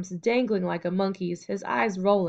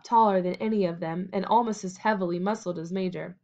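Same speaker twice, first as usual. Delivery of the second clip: ordinary delivery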